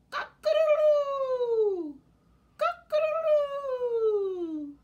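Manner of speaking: high, loud, slow crowing; rooster crow. Shown as a demonstration. Showing children the rooster, a loud crow.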